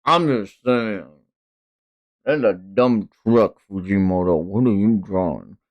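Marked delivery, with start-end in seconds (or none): none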